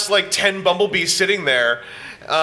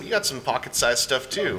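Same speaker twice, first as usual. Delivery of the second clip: usual speaking voice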